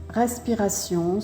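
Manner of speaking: usual speaking voice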